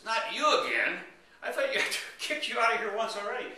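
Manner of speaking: loudly fed up with him; hammed-up annoyed barkeep; exasperated bartender. Bartender sees the man return.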